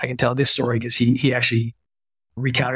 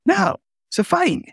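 much higher, punched-up retort; emphatic mock defiance. Doctor defending himself to the vice president, much higher emphatic retort. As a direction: fire it back with playful defiance.

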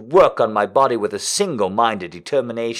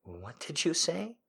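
breathy question; 6 semitones higher, 15 dB quieter than usual. Acted. Heroine's reply, quiet.

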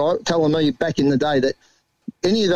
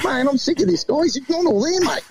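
higher, mock exasperated complaint; Johnny complaining about Jake, higher mock exasperation; fed-up Johnny; 9 semitones higher than usual. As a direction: imitate a fed-up friend. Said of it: "Johnny goes".

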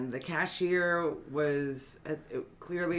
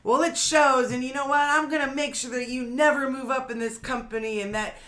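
high, loud, slowed outraged demands; raging old woman; 8 semitones higher, 8 dB louder than usual. Retold or imitated. Retelling the elderly customer raging at the cashier, mock fury. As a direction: hammer each line like an entitled customer losing it. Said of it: "And she was like".

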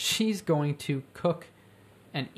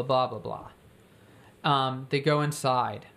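ordinary delivery

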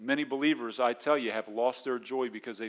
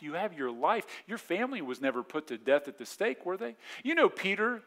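ordinary talk

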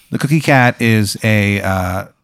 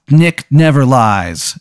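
slow, weighty declaration; solemn pronouncement; 3 semitones higher, 3 dB louder, much slower than usual. Acted. Townsfolk solemnly quote the prophecy book, slow. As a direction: lay it down slowly like scripture. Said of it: "It says so".

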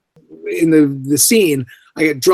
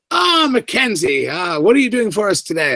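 Terry's greeting; 5 semitones higher than usual. Retold or imitated. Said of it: "Terry calls to me and he says".

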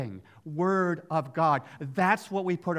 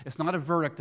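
ordinary talk, nothing marked

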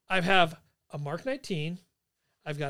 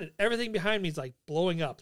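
usual speaking voice

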